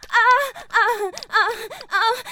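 repeated high moaning cries; high moans. Acted. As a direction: moan in rising excitement. Moaning as excitement builds.

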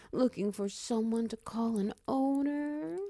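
normal talking voice

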